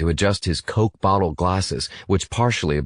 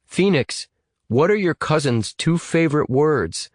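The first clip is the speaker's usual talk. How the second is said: higher, projected question, mildly raised; louder voice; 9 semitones higher than usual. A narrator's reading. Hacker tests the caller by speakerphone, raised voice. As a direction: raise the voice for the phone. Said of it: "In a louder voice".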